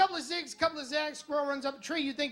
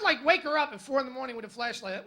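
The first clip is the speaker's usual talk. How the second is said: ordinary delivery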